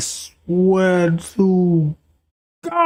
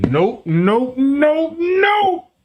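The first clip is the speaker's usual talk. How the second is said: "Nope, nope, nope, nope" climbing higher on each repeat; emphatic refusal; 7 semitones higher, much slower than usual. Acted. Refusing to pay for her habit, "nope" rising each time. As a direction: step every "nope" up in pitch to a high last one.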